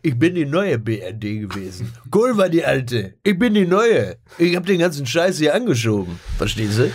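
puffed-up politician voice, proud and pushy; lightly hammed statesman swagger; mock chancellor boast. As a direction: brag like the ex-chancellor claiming the credit. Mocking Schröder bragging about BRD.

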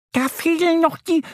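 very high squeaky duck voice; worried little duck. A narrator's reading. Annie the duck noticing something missing, worried. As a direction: point it out high and concerned. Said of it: "meint Annie besorgt".

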